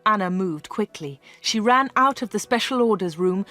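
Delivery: usual speaking voice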